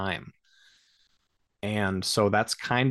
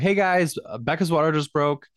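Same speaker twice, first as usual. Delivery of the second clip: urgent announcement; 6 semitones higher than usual. Retold or imitated. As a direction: call the news up the stairs in a hurry. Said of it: "I'm like".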